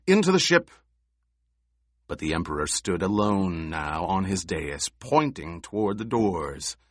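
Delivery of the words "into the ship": high, loud urgent plea; urgent shout. A narrator's reading. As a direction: yell at the Emperor to flee. Officer urges Emperor.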